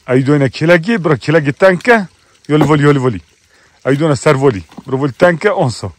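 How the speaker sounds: yüksek sesle sürüye bağırmak; koyun çağırma. Real, not acted. (sürüye bağıran çoban)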